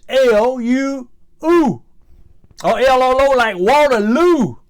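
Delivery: high and loud, spelling it out; exasperated rant. Retold or imitated. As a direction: work up into an indignant spelling rant.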